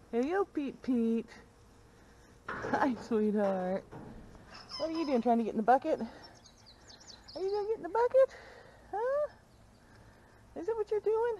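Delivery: high, soft sing-song pet talk. Real, not acted. Asking the goat kid about the bucket, high baby talk.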